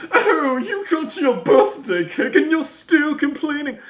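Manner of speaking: high, squeaky mocking impression; mocking smug voice. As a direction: sneer it like a smug pundit. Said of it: "sitting there like".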